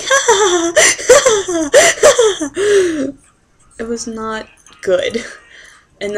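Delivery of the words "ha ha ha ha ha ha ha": high, loud cackling laugh, caffeine-crazed; manic laugh. Retold or imitated. Hyped on caffeine, cackling high and loud. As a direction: laugh like you've lost it from no sleep. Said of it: "I was just like".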